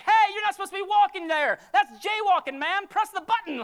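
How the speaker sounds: high, loud petty telling-off; mock scolding. Bystander scolding a healed cripple for jaywalking, mock outrage. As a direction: yell like an outraged rule enforcer.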